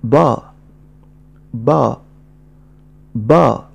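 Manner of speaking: با as one blended syllable; syllable drill. Shown as a demonstration. Teacher drilling learners: clear blended با. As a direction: repeat با for the class. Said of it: "فإنني سأنطق هكذا".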